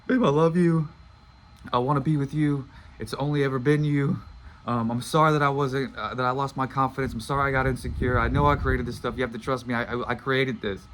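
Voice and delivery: passionate plea. Retold or imitated. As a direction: pour it all out, begging her to believe him. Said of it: "going off on this thing".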